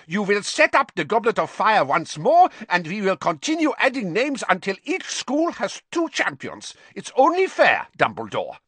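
high, loud demand; demanding foreign headmaster. Acted. Karkaroff demanding fairness from Dumbledore, insistent. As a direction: insist forcefully, pitch climbing.

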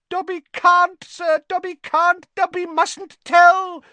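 very high, loud squeal; Dobby squealing. A narrator's reading. Elf refuses to tell Harry who did it, squealing. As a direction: squeal it like a panicked little elf. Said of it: "squealed the elf".

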